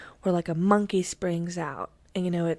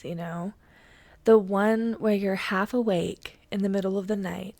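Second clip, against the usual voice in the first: normal talking voice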